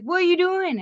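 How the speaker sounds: high-pitched mock bewilderment; imitating a startled friend; baffled retold question. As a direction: ask it high, as if baffled by what you found. Retold friend finding him in tailgate.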